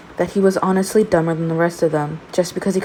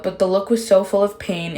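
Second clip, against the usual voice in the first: ordinary delivery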